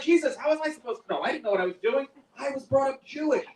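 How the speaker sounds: high-pitched self-defense; protesting plea. Acted. Comic pleading with Jesus at the gates, protesting high.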